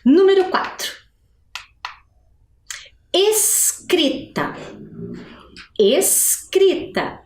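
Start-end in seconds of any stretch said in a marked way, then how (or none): 3.1-4.5 "Escrita" very slow, S and C apart; separated sounds. Shown as a demonstration. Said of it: "eu tenho sons aí separados". 5.8-7.2 "Escrita" very slow, S and C apart; separated sounds. Shown as a demonstration. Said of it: "eu tenho sons aí separados".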